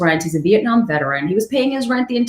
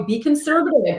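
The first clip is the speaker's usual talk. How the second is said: ordinary delivery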